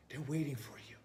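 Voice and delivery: soft unvoiced whisper. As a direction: breathe it like a secret to the crowd. Whispered line in sermon.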